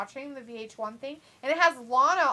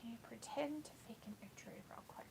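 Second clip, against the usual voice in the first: whisper; 20 dB quieter, faster than usual. Real, not acted. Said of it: "whispered request".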